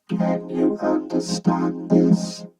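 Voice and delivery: slow, soft-edged robotic synth speech. Shown as a demonstration. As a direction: draw it out gently through a vocoder. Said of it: "Softer, that one".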